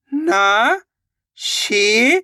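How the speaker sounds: ना, शी, each long and lifted; syllable-by-syllable sounding out. Shown as a demonstration. Teacher demonstrates reading, ना and शी drawn out one by one. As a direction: hold ना and शी apart. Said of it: "एक एक अक्षर का उच्चारण करके".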